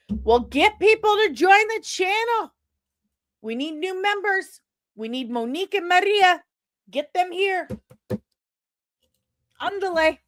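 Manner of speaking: high, pushy hurry-up voice; nagging co-host voice. Retold or imitated. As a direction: voice him telling her to hurry. Voicing co-host nagging her.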